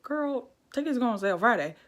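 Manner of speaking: blurting out the news to a friend; excited retelling. Retold or imitated. Telling best friend tickets are out, loud and urgent.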